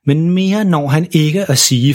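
plain reading voice

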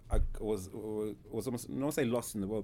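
usual speaking voice

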